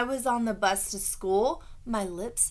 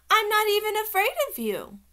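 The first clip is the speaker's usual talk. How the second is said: put-on high, loud kid boast; bold childish declaration; 12 semitones higher, 7 dB louder than usual. A narrator's reading.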